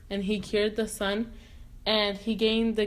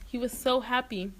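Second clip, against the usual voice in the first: ordinary delivery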